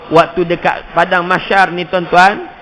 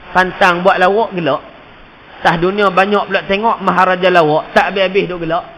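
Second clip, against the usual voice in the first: usual speaking voice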